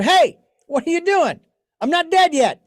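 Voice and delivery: high, loud retold yell from a hospital bed; mother yelling out. Dying mother yells at her chatting family, high loud mock yell. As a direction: bark it out like a feisty old mother. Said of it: "And she yells out".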